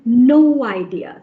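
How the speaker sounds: no idea slowly linked as no-widea; slow "no idea" with a w between the words; linking w sound. Shown as a demonstration. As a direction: stretch no and glide through a w into idea. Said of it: "you can hear the w sound".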